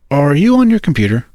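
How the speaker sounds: ordinary delivery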